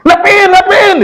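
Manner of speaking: high-pitched screech from behind a door; imitated frantic woman's scream; woman yelling outside. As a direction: shriek to be let in, very high. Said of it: "Abis dia teriak-teriak".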